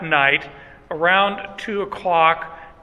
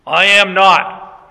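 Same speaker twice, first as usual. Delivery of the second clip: slow, louder words in a lightly imitated Galilean accent; 6 dB louder, slower than usual. Peter denying it to the doorkeeper, in a put-on Galilean accent. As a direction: stretch it out in a mock Galilean accent. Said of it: "in the accent".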